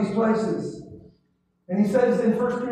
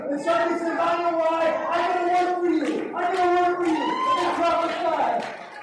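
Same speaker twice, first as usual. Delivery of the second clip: full-force preaching; 5 semitones higher than usual. Acted. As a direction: whip the congregation up.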